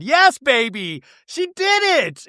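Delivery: high, drawn-out triumphant squeal; gleeful cheering. Real, not acted. Gleeful cheer at anticipated slip.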